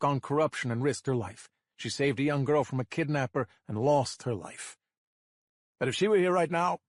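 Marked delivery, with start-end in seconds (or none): 5.8-6.8 throat catching. A narrator's reading. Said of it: "my throat catches".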